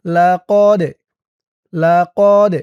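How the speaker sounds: "লাকদ" loud, bouncing off the final d; qalqalah push. Shown as a demonstration. Teacher demonstrating qalqalah, লাকদ with a bounced d. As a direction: push the last d of লাকদ into an echo. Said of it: "ধাক্কা দিয়ে পড়তে হবে".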